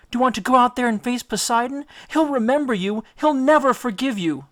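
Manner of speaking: high, pleading goddess voice; a lovesick nymph clinging to him, pleading high and fast; Calypso pleading. Acted. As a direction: beg him to stay, voice lifted high and urgent.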